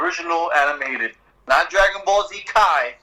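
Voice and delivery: normal talking voice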